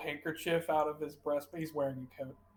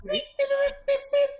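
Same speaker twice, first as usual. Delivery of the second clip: very high, loud chirping beeps; high loud beeping mouse chatter; mouse chirps; 6 dB louder than usual. Acted. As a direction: squeak back like a tiny mouse. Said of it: "And then the mouse is like".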